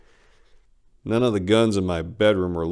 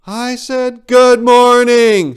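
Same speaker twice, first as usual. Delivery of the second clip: high, much louder, slow Good morning; loud eagle voice; 14 dB louder, much slower than usual. Acted. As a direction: boom it out as the giant eagle. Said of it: "she loudly stated".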